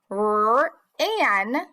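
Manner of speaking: "R, an" split, a held R before the ending; first sound, then ending. Shown as a demonstration. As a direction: hold "R", pause, then "an". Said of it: "first sound and then the ending".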